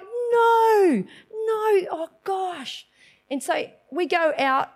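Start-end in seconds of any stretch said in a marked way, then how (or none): 0.0-2.9 high, drawn-out wail of dismay; hammed-up despair, easing off at the end. Woman retells her panic when her mother didn't respond, mock dismay.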